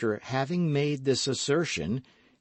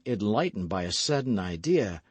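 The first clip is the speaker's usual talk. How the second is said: plain reading voice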